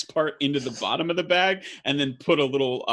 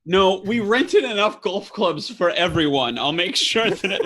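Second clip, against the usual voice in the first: laughing; 7 semitones higher, 5 dB louder than usual. Real, not acted. GM laughing at players' antics.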